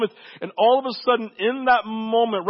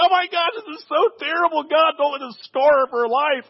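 panicked parent; 8 semitones higher, 3 dB louder than usual. Retold or imitated. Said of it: "You're like".

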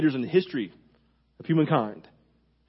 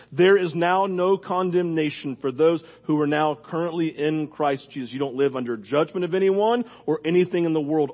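ordinary talk

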